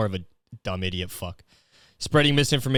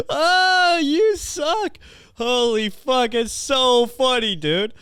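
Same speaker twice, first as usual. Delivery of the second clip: high, slow words squeezed through laughter; real high laughing talk; 11 semitones higher, much slower than usual. Real, not acted. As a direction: talk while still cracking up. Laughing at own bit.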